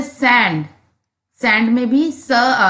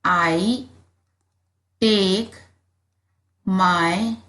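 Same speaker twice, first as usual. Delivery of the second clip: slow dictation; 3 semitones lower, much slower than usual. Shown as a demonstration. Teacher dictating the answer slowly for pupils to write. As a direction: dictate one word at a time with pauses. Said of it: "आप यहाँ लिखेंगे when".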